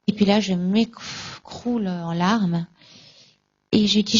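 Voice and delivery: normal talking voice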